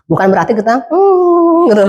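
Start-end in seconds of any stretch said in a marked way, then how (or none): 0.9-1.7 high spooky ghost hum; mock kuntilanak hum, held high. Shown as a demonstration. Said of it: "Mak Kunti". (senandung tinggi ala kuntilanak)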